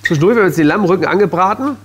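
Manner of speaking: ordinary delivery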